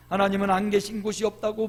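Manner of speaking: usual speaking voice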